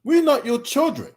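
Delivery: high-pitched indignant rebuke. Real, not acted. Objects to being talked down to.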